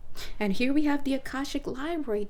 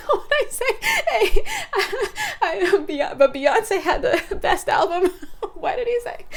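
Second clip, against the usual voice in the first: high, loud, words breaking into laughter; real laughing fit; 10 semitones higher, 7 dB louder than usual. Real, not acted. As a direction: crack up while trying to get a quote out. Cracking up while misquoting a famous interruption.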